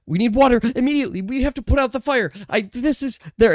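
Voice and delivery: fast, high, frantic pleading. Acted. Guests urge the guard to bring water, frantic. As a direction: rush the words out in alarm. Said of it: "they're like".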